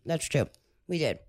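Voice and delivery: chewing while talking. Real, not acted. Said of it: "Sorry, I'm chewing to the mic".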